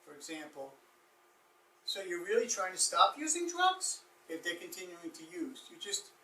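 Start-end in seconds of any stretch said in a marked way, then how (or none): 1.9-4.0 "drugs" lifted at the end as a question; rising question tune. Shown as a demonstration. Counselor showing a reflection turned into a question, rising tune. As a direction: end on a high point. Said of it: "ending it with a high point".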